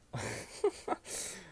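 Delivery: breathy, voiceless chuckle; quiet wheeze of amusement; laugh. Real, not acted. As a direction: chuckle on the breath at your own joke. Laughing at own joke.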